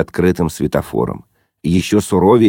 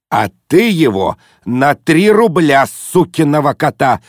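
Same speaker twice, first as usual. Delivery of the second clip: firm conviction; 8 semitones higher than usual. A narrator's reading. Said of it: "убеждённо заметил папаша".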